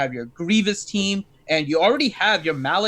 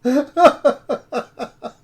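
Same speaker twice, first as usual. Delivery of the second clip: laughing; 7 semitones higher, 5 dB louder than usual. Real, not acted.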